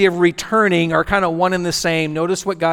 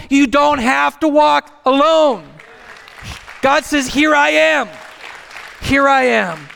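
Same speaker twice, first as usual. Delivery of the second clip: rising, higher preacher's cry, building each line; preaching at full force; 7 semitones higher than usual. Acted. Pastor tells the church God is here, preaching at full force. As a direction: lift the room with it, climbing higher.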